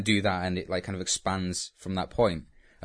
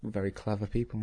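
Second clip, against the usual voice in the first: dry, sarcastic jab; 4 dB quieter than usual. Real, not acted. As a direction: say it meaning the opposite. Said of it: "That was a sarcastic comment".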